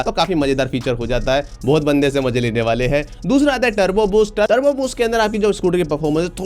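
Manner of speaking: usual speaking voice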